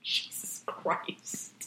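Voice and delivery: slow breathless whisper; whispered exasperation. Real, not acted. Teller recalling the ordeal, in a slow exasperated whisper. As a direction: breathe it out, worn out. Exhausted remark after story.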